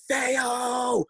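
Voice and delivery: high, drawn-out yell held back in volume; mock distant scream, names stretched out; imitated screaming. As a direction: imitate someone screaming down the street after you. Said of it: "you can hear her just screaming at me".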